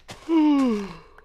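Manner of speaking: loud breathy yawn; a real late-night yawn out loud; tired yawn-sigh. Real, not acted. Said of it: "So sorry".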